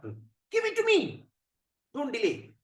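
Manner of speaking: high, drawn-out, imperious order; impatient demand. Acted. Shiva demanding the stuck morsel from Narada, impatient. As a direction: demand it at once, piercingly. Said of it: "Lord Shiva, you're very angry".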